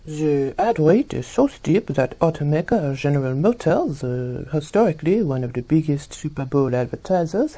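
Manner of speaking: crisp newscaster delivery; news read. Acted. News-style report read.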